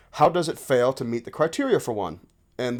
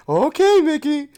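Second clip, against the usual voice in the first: high singsong reply; imitated lilting brush-off; playful doll chat; 12 semitones higher, 4 dB louder than usual. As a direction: answer a doll in a put-on high voice. Acted reply in the doll dialogue.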